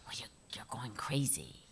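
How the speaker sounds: low, hushed whisper; mock whisper. Quoting the director in a hushed whisper. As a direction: breathe it like a secret.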